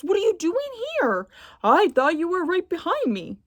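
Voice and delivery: astonished he is here; surprised. Acted.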